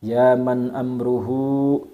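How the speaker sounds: slow, measured Arabic prayer with long drawn vowels; slow recitation. Shown as a demonstration. Reading a prayer for viewers to write down, slow recitation. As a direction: recite phrase by phrase, pausing after each. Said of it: "Saya baca perlahan".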